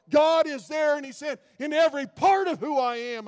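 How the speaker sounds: full-force sermon, rising with passion; preaching. Acted. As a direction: build it up and preach it hard.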